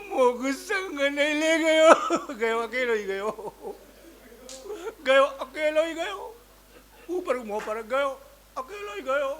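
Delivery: hammed-up mourner's keening; mock wailing. A mourning woman wails that he went alone. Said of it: "एक आयु रो, रो, रो के आ रही".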